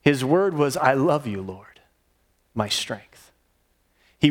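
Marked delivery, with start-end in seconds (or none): 0.8-3.3 soft, breathy whisper fading to nothing; whispered prayer. Real, not acted. Preacher whispers David's psalm to the Lord, a soft prayer. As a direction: breathe the words like a private prayer.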